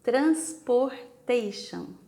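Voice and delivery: Transportation said slowly and loud, every syllable clear; model word for repeating. Shown as a demonstration. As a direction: spell out Transportation slowly for the class to copy. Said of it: "Repeat, please".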